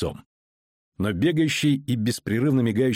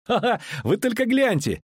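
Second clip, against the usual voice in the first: high-pitched acted laughter; high, gleeful cackling call; laughing; 12 semitones higher than usual. A narrator's reading. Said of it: "залилась хохотом".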